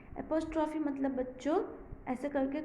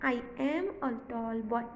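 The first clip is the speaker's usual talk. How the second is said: very slow, word by word; slow model reading; much slower than usual. Shown as a demonstration. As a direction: read the short form slowly. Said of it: "So ये हो गया".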